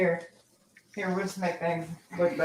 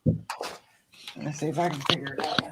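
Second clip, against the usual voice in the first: whispering; 5 semitones lower, 4 dB quieter than usual. Real, not acted. Muttering to self while cooking.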